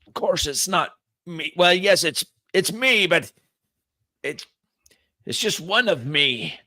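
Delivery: high, flustered villain correcting himself; huffy, stumbling self-correction; testy villain. Acted. Game master answers as villain Divot.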